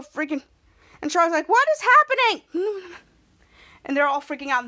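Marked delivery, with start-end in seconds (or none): 1.5-2.9 high, slow, alarmed; panicked question. Retold or imitated. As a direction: sound lost in the dark. Charlotte panicking in dark.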